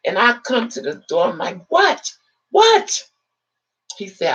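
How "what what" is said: high yelled challenge; sharp shouted what. Retold or imitated. Woman at the door, yelling what. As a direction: bark it through the door.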